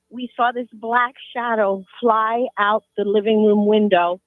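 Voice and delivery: ordinary talk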